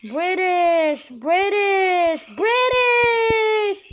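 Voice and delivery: British, British, British rising from firm to a full shout; building shout. Real, not acted. Kid vlogger chanting British at her screen, building to a shout.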